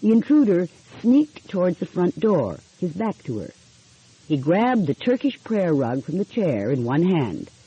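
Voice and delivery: usual speaking voice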